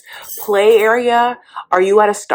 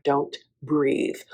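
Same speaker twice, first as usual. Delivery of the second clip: hushed warning; 2 semitones lower, 7 dB quieter, slower than usual. Retold or imitated. Brandon briefing Julia about Betty, soft hushed warning. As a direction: quietly coach her to stay still. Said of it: "gives her the lowdown".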